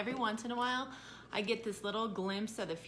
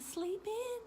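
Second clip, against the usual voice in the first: much higher mocking singsong; mock his selfishness; 13 semitones higher than usual. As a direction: mom mocking son's selfishness.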